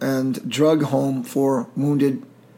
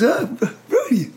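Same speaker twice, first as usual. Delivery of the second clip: surprised recognition; 10 semitones higher, 4 dB louder than usual. Retold or imitated. Friend recognizes him.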